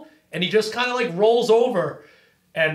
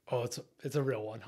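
awed hush; 6 semitones lower, 12 dB quieter, faster than usual. Retold or imitated. Shark feels the big fish.

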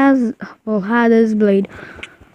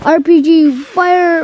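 raised, higher call to fire; game callout yell; shouting a command; 6 semitones higher than usual. Real, not acted. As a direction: bark the order to fire. Calling an attack in game.

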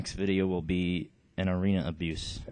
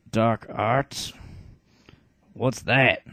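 dim heavy brute; 2 semitones higher, 5 dB louder, much slower than usual. Acted. Bartray quizzing the shaman, loud dim brute voice. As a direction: play the stupid heavy, loud and blunt. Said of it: "the stupid character".

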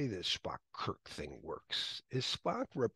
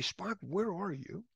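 slow, higher call for Spock; Kirk calling; 8 semitones higher, 4 dB quieter, slower than usual. Retold or imitated. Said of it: "he'll just say".

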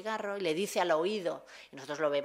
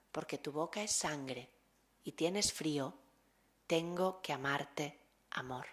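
poem recital; 2 semitones lower, 4 dB quieter than usual. Acted. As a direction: let each love line land gently. Recites Margarit love poem.

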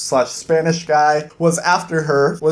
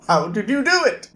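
high mock-villain laugh, then taunting; lightly voiced smug queen cackling at her captor; gloating villain queen; 6 semitones higher than usual. Queen caught with gun on Jazz, mock gloating.

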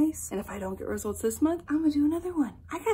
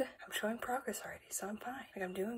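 whispered through panting, much softer; barely voiced, out of breath, insisting she's fine; breathless whisper; 12 dB quieter than usual. Real, not acted. Mid-workout to her viewers, a winded whisper.

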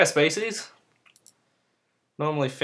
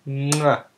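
kiss sound. Real, not acted. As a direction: blow a big kiss. Kissing her beloved Nutella.

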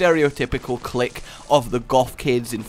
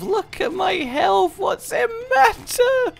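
much higher, slow airy shrug of a voice; carefree mindset voice; slower than usual. Retold or imitated. Player voicing his reckless mindset about damage, high and slow. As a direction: toss it off like nothing can hurt you. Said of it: "I'm kind of firmly in the mindset of".

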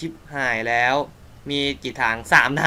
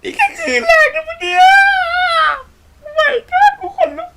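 very high, loud shriek between laughing and crying; panicked after the scare, voice cracking; real fright, screaming and half-crying; terrified scream; 9 dB louder than usual. Real, not acted.

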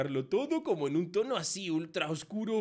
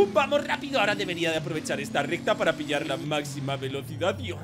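ordinary delivery

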